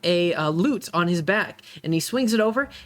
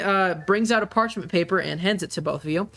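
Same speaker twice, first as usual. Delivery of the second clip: ordinary delivery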